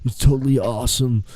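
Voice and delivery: lightly voiced spacey dude; laid-back dude. Co-host as a dude wrestler recounting the match, lightly put on.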